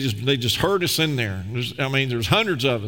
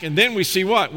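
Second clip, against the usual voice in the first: normal talking voice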